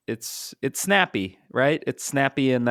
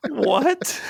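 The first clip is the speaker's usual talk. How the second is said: high, louder disbelieving what; incredulous what; 4 dB louder than usual. Real, not acted. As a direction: squeak it out in disbelief. Incredulous at the newspaper line.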